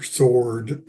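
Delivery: ordinary talk, nothing marked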